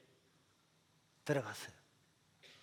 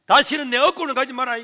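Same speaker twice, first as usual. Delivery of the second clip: loud demand; 7 semitones higher, 12 dB louder than usual. Retold or imitated. Mother's final demand.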